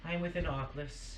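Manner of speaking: tiefling voice. Acted. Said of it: "The tiefling".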